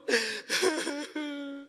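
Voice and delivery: laughing through tears; a choked laugh mixed with crying; tearful laugh-sob. Retold or imitated. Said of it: "Dia nangis". (tawa bercampur tangis)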